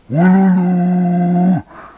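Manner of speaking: loud high burst of laughter; relieved laughter. Real, not acted. Tree down after struggle.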